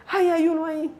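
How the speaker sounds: high-pitched retold cry of alarm; imitating alarmed daughter. Daughter alerts her mother in the shower, imitated high alarm. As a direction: imitate a girl suddenly pointing out an intruder. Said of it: "Cuando Vane dice".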